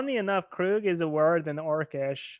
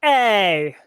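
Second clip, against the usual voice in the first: a moderately raised calling-out; mild shout; 4 dB louder than usual. Acted. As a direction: call out to catch someone's attention. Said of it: "And Remy shouts".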